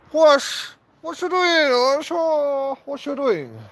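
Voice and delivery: very high, loud squeaky YouTuber voice; PewDiePie impression. Vlogger showing off a bad PewDiePie voice, very high and loud. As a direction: pitch way up and yelp it like a gamer. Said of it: "I'm gonna do a PewDiePie accent right now".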